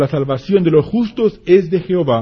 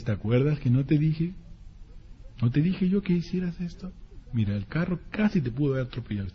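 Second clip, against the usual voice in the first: gentle parent; 2 semitones lower, 10 dB quieter than usual. Retold or imitated. Father gently explaining the danger to Juanito, softly. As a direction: speak softly and lovingly to the child.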